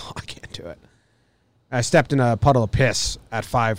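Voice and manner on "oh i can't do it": very soft, low near-whispered groan of reluctance; barely voiced, too tired to cope; weary reluctance. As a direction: recall it under your breath, worn down. Retelling being woken at five thirty.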